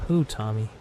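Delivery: ordinary delivery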